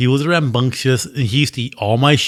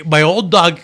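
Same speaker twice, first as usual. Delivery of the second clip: ordinary talk, nothing marked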